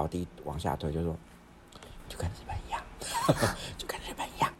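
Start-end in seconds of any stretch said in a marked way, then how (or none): 2.1-4.5 soft conspiratorial whisper with a laugh. Retold or imitated. Sister whispering to brother on the way down, giggly whisper. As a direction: lean in and whisper it.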